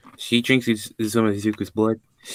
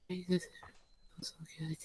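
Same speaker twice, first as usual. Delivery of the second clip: high, very soft, savouring voice; tiny high murmur of pleasure; turned-on murmur; 8 semitones higher, 18 dB quieter, faster than usual. Acted. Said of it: "She gets turned on and drinking his blood".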